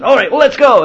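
very high, loud call to go; rousing showman. Retold or imitated. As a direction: launch the cart with gusto. Charioteer starts the cart.

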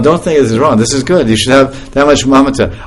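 ordinary talk

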